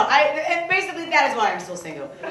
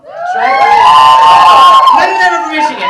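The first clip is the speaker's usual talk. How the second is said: high shouted sarcasm; shouted sarcastic aside; 16 dB louder than usual. Real, not acted. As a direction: yell it sarcastically.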